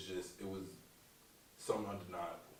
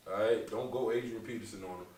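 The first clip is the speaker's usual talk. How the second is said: usual speaking voice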